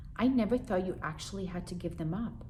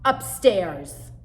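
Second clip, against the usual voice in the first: louder one-word order; mother ordering; 11 dB louder than usual. Acted. Mother sending daughter upstairs, loud. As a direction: send her upstairs. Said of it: "said Mom".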